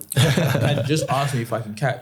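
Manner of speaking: half-joking disbelief at being measured; incredulous mock question. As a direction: throw it back at them in disbelief. Mocking the combine hand measuring.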